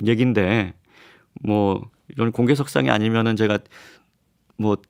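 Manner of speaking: normal talking voice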